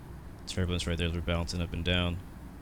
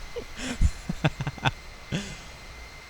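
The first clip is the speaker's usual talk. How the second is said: high breathless giggle; 12 semitones higher, 3 dB quieter than usual. Real, not acted. Laughs on after the ad joke.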